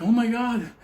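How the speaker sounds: hammed-up shock, breaking into a laugh; mock horrified onlookers. People outside seeing stabbed Eleanor, mock alarm. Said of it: "people outside like".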